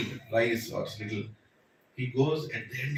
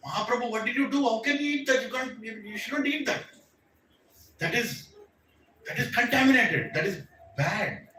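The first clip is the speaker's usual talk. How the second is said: much higher, anguished protest; distraught pleading; 7 semitones higher than usual. Retold or imitated. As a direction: plead in horror at what he's done. Raghunath aghast at master.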